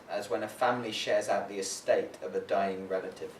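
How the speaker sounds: measured prose reading voice; literary reading. Acted. Poet reading Kokoschka fragment to audience, measured reading. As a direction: read the fragment like a memoir.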